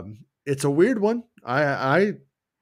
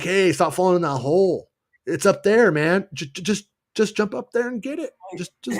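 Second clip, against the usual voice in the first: higher, needling backseat gamer; tipsy heckler; 7 semitones higher than usual. Retold or imitated. With a beer, heckling friend who keeps falling, mock needling. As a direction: razz the player from the couch, pitched up. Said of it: "I'm gonna be like".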